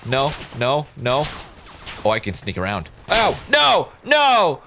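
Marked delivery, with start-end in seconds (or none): 3.1-4.6 high, loud panicked no; panicked shout. Real, not acted. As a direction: yell it as you get hit. Getting shot.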